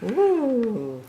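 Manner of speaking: high bright laugh then an ooh; delighted laugh. Real, not acted. Delighted by the picture.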